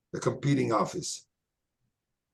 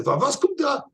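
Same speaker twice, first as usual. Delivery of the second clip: retold question, pitched up; 12 semitones higher than usual. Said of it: "He said".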